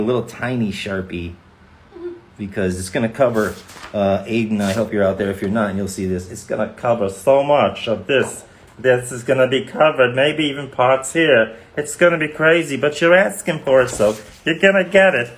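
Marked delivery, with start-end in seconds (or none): 6.3-15.3 Chris Pasalacqua. Acted. Signer warning a fan his signature will cover everything, Chris Pasalacqua voice. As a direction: lay on a Long Island accent, building higher. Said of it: "You sound like Pasalacqua".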